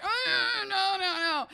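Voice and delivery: high, loud panicked "oh no"; panicked cry. Retold or imitated. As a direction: squeal it in horror. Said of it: "I was like".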